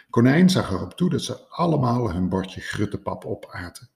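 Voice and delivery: ordinary delivery, nothing marked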